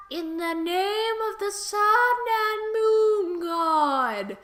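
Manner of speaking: slow, sing-song invocation; mock incantation. Quake bluffs the gods' phrase, drawn-out mock incantation. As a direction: chant it out, stretching the words grandly.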